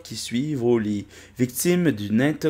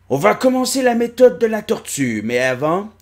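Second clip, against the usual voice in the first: higher, louder master announcing; old master voice; 8 semitones higher, 4 dB louder than usual. Acted. Maître annonce l'entraînement. (annonce du vieux maître)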